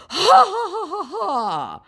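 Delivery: high, slow fake laugh; a sarcastic spelled-out ha ha; mock scammer laugh. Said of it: "said the scammer".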